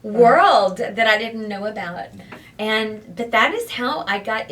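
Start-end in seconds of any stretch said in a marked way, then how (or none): 0.0-2.1 excited. Retold or imitated. Retold overwhelmed discovery quote.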